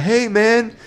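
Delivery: high-pitched mock hipster greeting; put-on hipster voice; Denver hipster. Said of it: "with all those fucking hipsters".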